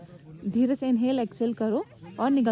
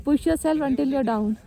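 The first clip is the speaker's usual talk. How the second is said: mocking his motto; much slower than usual. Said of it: "फिर तेरा dialogue तेरे को ही मारूंगी मैं".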